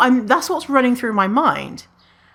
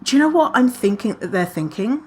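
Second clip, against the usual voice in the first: ordinary delivery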